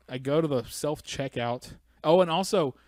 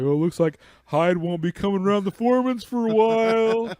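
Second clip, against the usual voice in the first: higher, louder sing-song; sitcom mom voice; 8 semitones higher, 9 dB louder than usual. Acted. Joke line about Hyde, hammy sitcom voice. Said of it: "I might say".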